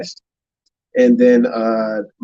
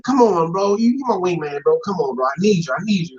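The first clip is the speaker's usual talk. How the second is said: lightly acted begging, pitched up at first; pleading friend; 7 semitones higher than usual. Friend begging his wingman to come, lightly pleading. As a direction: coax your buddy, half whining.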